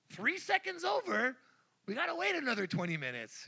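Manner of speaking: high, loud, fast exasperated burst; exasperated outburst. Real, not acted. Chef venting about caramel timing, high and fast. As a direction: blurt it out in rising exasperation.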